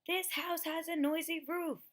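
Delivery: yelled high and loud; yelling. Acted. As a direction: yell it up at the roof in frustration. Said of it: "yelled Owl".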